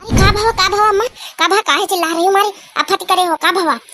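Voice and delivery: what's all the fuss; startled annoyed response; startled reply. Acted. Annoyed reply to yelling mother.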